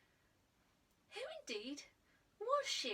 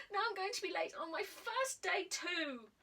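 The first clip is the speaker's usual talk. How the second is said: high, loud whiny wail; 7 semitones higher, 4 dB louder than usual. A narrator's reading. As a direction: moan about being late. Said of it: "he howled".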